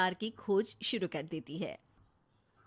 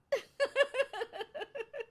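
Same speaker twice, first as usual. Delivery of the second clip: gleeful laugh. Acted. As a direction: laugh at the lovesick pair. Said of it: "Neha हंसने लगती है". (ऊँची खिलखिलाती हँसी)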